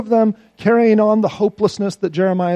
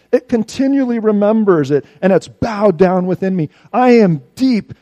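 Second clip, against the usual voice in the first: anguished; 4 semitones higher, 8 dB louder than usual. Acted. Voicing Jeremiah's anguish.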